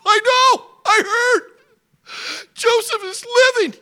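very high, loud eager father; excited old father. Acted. Father interrupting son Levi, high and loud. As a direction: jump in before he finishes.

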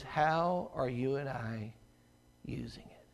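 slow, hushed whisper; whispered question. Real, not acted. Preacher asking the congregation, hushed whisper. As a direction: breathe the question out softly.

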